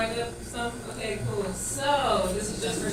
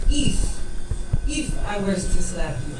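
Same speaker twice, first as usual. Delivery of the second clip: pointed, louder "If", then a pause; Nigerian boyfriend correcting; 3 semitones lower, 6 dB louder than usual. Retold or imitated. Nigerian boyfriend explaining his threat to the phone, pointedly imitated. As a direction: stress the "If" in a lightly imitated Nigerian accent. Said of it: "and he said".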